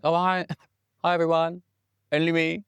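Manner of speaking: breezy mock-humble actor's hello; Ian McKellen impression. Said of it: "Sir Ian McKellen over here, being like".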